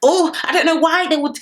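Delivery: mock pleading, pitched high; mimicking someone's wounded innocence; playing woe-is-me. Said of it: "you started playing woe is me".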